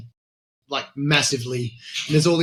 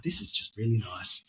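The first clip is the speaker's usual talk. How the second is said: much softer, hushed and sincere; 5 semitones lower, 9 dB quieter than usual. Retold or imitated. Recalling a peaceful sunrise on the hilltop, hushed and sincere. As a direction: murmur it like a peaceful dawn moment. Quiet moment watching sunrise.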